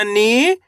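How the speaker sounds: "नी" held long, rising at the end. Shown as a demonstration. Teacher answers how to read it, long rising "नी". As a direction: draw out "नी" with a lift. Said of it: "खींच के पढ़ा जाता है".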